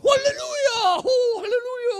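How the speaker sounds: high, loud hallelujah shout; joining loud praise. Retold or imitated. Preacher joining the congregation, loud ecstatic shouting. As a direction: throw yourself into the praise. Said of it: "saya bilang".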